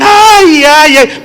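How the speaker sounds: high, hammed-up fake lament; mock wailing mourner. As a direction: wail like a paid mourner. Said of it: "había una manipulación de las emociones y estaban". (lamento fingido de plañidera, agudo y exagerado)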